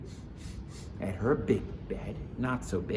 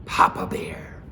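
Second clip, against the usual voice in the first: deep Papa Bear; 5 semitones lower, 7 dB louder than usual. A narrator's reading. Bears introduced in voices.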